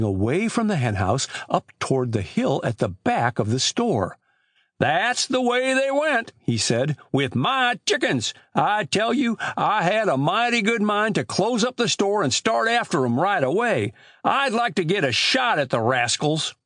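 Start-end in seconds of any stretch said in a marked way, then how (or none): 4.8-6.3 put-on high, slow rustic old man; Amos Grice. Old storekeeper telling boys of lost chickens, high drawling voice. 7.1-16.5 put-on high-pitched rustic old man; old country storekeeper. Old storekeeper grumbling to boys about chicken thieves, high rustic voice.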